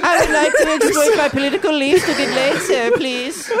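Oliver Twist begging. Acted. Mocking millennials as Oliver Twist, high pleading beggar. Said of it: "millennial Oliver Twist, new character".

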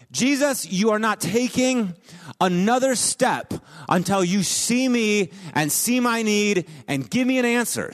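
high, pressing, slowed demand; mother on her knees demanding an answer from Jesus; insistent demand. Retold or imitated. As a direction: plant yourself and make him answer. Woman blocks Jesus' way, demanding.

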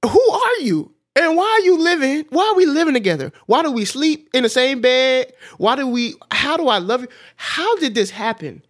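high, loud, half-laughing disbelief; escalating high bewildered questions; incredulous wonder. Retold or imitated. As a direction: marvel out loud, almost crying with laughter. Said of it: "I'm like".

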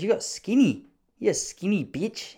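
lightly imitating the blokey uncle; teasing uncle. Mimicking his uncle teasing him about getting skinny. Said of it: "He goes".

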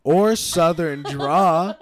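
very slow, loud, southern draw; slow know-it-all. Retold or imitated. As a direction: drag out "Or southern draw" to mock her. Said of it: "You're like".

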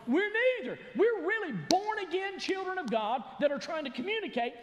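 high, loud, shouting declaration; loud pleading shout; fervent protest. Acted. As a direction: cry out that they are God's children. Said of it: "here they are going".

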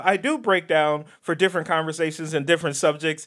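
usual speaking voice

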